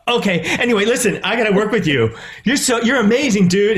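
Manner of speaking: high-pitched retold fan gush; eager gushing. Actor telling winner he must work with him, eager gushing. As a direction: press your case eagerly, pitched well up.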